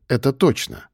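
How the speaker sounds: ordinary delivery, nothing marked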